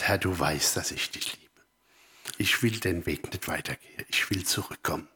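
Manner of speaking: low, soft confession to God; hushed, humble plea; quiet retold prayer. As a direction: pray it under the breath. Retells own prayer of return.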